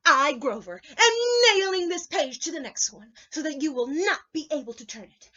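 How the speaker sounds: loud declaring puppet voice; proud Grover. Acted. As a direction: proclaim it with gusto. Grover proclaiming while hammering.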